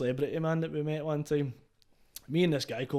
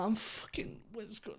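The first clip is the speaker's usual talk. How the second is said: whispered, falling away in disbelief; stunned whisper; 7 semitones higher, 9 dB quieter, slower than usual. Retold or imitated. In the hotel room, too stunned to speak above a whisper. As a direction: breathe it out too shocked to finish. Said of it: "And I'm like".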